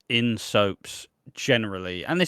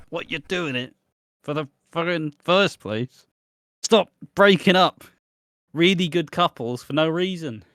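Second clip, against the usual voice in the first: higher-pitched, emphatic comic outrage; exasperated rant; 4 semitones higher than usual. Acted. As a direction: plead with the soap writers, thoroughly fed up. Ranting at soap writers about splits.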